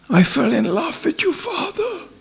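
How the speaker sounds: put-on high, soft girlish confession; mock little-girl whisper-voice. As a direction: imitate an old lady gone girlish. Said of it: "she was like a little girl".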